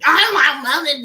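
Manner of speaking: loud, rude snap; snapping. Retold or imitated. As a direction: snap it at the server. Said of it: "the girl is just snapping".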